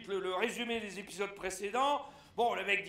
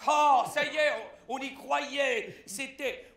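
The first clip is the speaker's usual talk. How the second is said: normal talking voice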